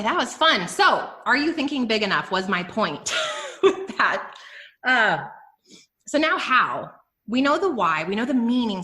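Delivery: usual speaking voice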